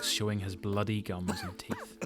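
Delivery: normal talking voice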